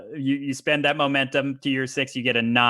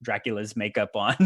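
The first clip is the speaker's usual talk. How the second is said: ordinary delivery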